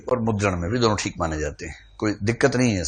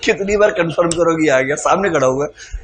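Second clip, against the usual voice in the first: impatient heckle; 6 semitones higher, 5 dB louder than usual. Retold or imitated. Audience heckler continues.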